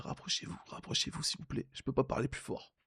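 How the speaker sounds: hoarse whisper, barely voiced. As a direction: whisper it like your voice is gone. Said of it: "j'avais la voix cassée".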